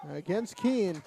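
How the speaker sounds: ordinary talk, nothing marked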